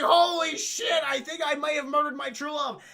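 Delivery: loud, high mock shock; acted panicked outburst; horrified realization. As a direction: exclaim in hammed-up alarm. Acted princess realizes her mistake.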